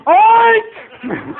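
long, very high, loud squeal of an oink. Retold or imitated. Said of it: "Louder, Bemis, louder".

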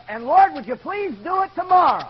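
high, loud mock-fervent plea; praying out loud. Gordy praying again on his knees, loud plea. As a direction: belt the request up to heaven. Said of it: "got back on his knees and said".

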